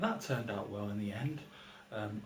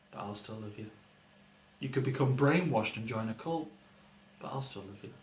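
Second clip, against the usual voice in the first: poem performance. Acted. Father reciting a poem to his sleeping son, deadpan.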